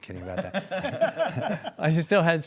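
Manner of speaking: laughing through a joke, high and slow. Real, not acted. Joking about money with the host, laughing. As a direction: chuckle out the take-back of the joke.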